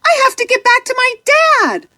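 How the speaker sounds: pitched well up, desperate; pleading cry. A narrator's reading. Lost son wanting his father back, pleading cry. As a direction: insist on getting home to dad. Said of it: "cries Nemo".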